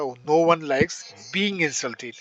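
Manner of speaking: drawn-out phrase for students to copy; teacher slowly spelling out a new phrase for the class; slow dictation. Shown as a demonstration. Lay the words down slowly, one by one. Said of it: "teacher dictates sentence".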